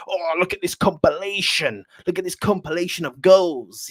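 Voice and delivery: lightly mocking fanboy gush. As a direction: send up the fans who drool over highlight reels.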